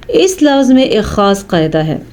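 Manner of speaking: ordinary talk, nothing marked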